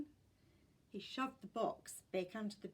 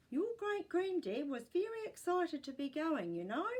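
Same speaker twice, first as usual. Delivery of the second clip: put-on old woman voice; 7 semitones higher, 4 dB louder than usual. A narrator's reading. Great gran voiced in story.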